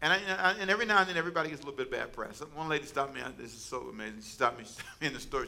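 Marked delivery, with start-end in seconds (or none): none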